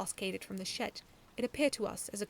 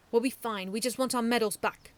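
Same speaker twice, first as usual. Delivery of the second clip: growled; 3 semitones higher, 8 dB louder than usual. A narrator's reading. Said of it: "Samantha growled".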